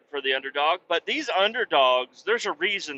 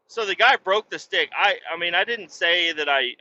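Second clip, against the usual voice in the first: ordinary talk, nothing marked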